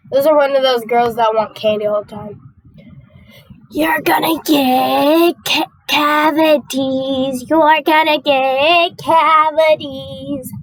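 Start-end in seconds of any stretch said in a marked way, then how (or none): 3.7-10.5 very slow, drawn-out taunt; slow singsong-style scolding; mock warning. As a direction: stretch every word out long and teasing. Mock warning to candy-demanding girl.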